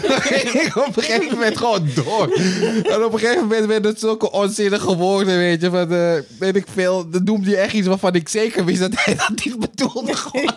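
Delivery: real laughter while telling the story; laughing. Real, not acted. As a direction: giggle your way through the memory. Laughing retelling of the stuttering joke.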